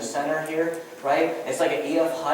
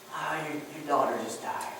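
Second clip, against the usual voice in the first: servant breaking bad news; 5 semitones lower, 7 dB quieter than usual. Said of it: "a servant comes over to Jairus and says".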